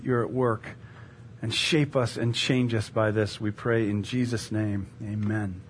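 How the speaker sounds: low, soft prayer voice. Real, not acted. Preacher praying with the congregation before teaching, hushed prayer. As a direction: pray it quietly and reverently.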